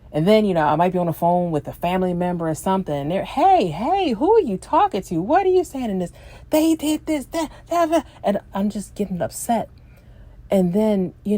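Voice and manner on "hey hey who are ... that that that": high-pitched worked-up rant, imitated; mock yelling, pitch jumping up on each jab; road-rage yelling. Yelling at other drivers while on the phone with family, mock road rage. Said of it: "I just yell and scream".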